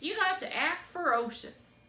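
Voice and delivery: put-on loud, high old woman voice. A narrator's reading. Old woman telling the rabbit to be fierce, loud and high. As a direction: coax him to be fierce.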